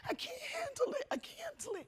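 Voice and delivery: desperate whispered collapse; broken whisper. Retold or imitated. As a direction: breathe it out as if at the end of your rope. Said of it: "I used to think it was the end".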